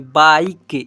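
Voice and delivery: बाइक loud and low, with a full "eye" vowel. Shown as a demonstration. Teacher showing I read as itself, बाइक loud with "eye". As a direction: punch बाइक as "baa-ik", final E silent. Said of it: "आई के रूप में".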